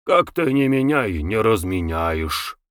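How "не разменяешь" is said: usual speaking voice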